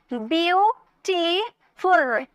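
Beautiful very slow, byu... ti... ful; three separated beats on beautiful; syllable by syllable. Shown as a demonstration. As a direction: stretch each syllable of beautiful, pausing between. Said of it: "तीन syllable".

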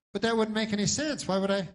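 puzzled protest; dog. Acted. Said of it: "And then the dog goes".

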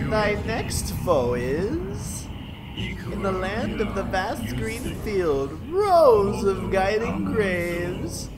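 slow, high, grand oracle voice; hammed-up fantasy narrator; mock epic narrator. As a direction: intone it like an ancient prophecy.

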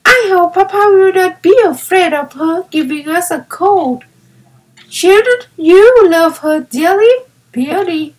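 eager young girl playing in the snow; child delighted with her new playmate; little girl Violet. A narrator's reading. Violet speaks in story.

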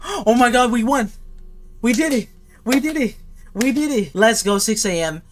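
high, gasping cries of victory; thrilled winner's cheer; elated celebration. Real, not acted. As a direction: exclaim in disbelief and joy, voice way up. Wins the night.

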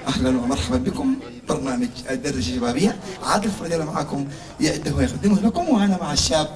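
very low, composed host voice; measured low-pitched programme welcome; TV presenter introduction. Acted. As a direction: open the show in a deep, formal announcer register.

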